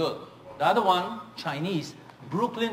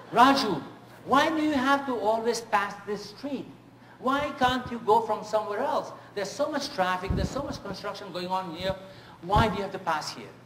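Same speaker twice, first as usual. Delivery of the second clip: higher-pitched, louder mock complaint; imitating a complaining guest; 5 semitones higher, 3 dB louder than usual. Guest in the car grumbles to Raju, high and peeved. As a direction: whine at the driver about the route.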